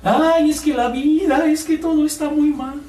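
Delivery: high, whining mock lament. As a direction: mimic a believer who only whines. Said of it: "llore y llore".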